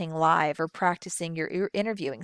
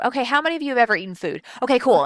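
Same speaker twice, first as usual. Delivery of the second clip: brisk, louder, tossed-off opener; rushed presenter; 6 semitones higher, 7 dB louder, much faster than usual. Acted. Presenter rushing through an opening question, hurried and high. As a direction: rattle it off fast and high. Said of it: "they move right on through too quickly".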